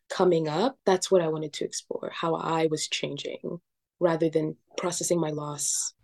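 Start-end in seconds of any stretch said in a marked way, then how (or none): none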